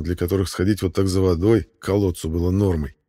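ordinary delivery, nothing marked

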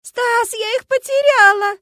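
high, loud sobbing wail. A narrator's reading. Lyuska wails to Stas, sobbing loudly. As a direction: wail it in panic. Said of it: "рыдания и Люськин вопль".

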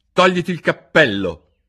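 a king pushing his jury; stern king. Acted. King urging the jury, booming.

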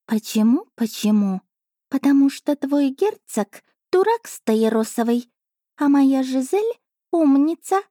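high, slow purring cat voice; a smug little cat savouring her dinner; chatty cat familiar. Acted. Cat familiar telling princess about dinner, high slow voice.

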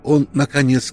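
ordinary delivery

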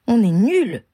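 cri de rage, plus fort et aigu; grognement furieux. A narrator's reading. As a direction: crache ta frustration. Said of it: "grogna Janice". (cri de colère de Janice)

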